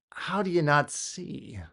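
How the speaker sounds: ordinary talk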